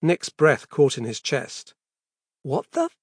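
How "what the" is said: shocked. Acted. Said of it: "stared at his son with shock".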